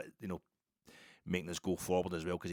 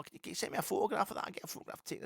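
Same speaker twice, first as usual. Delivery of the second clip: mocking tourist voice; 13 semitones higher, faster than usual. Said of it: "You know".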